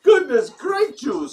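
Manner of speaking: high, loud and slow exclamation; excited exclaim. Real, not acted. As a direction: shout it out in delight.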